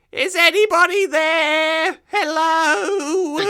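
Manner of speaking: very high, loud, frightened call into the dark; scared calling out. Retold or imitated. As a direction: call out, terrified, into the cave. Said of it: "just going".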